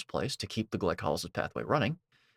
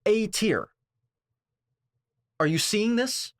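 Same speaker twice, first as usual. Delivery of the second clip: high, loud, incredulous outburst; outraged disbelief; 13 semitones higher, 5 dB louder, slower than usual. Real, not acted. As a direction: react loudly, pitched way up in disbelief.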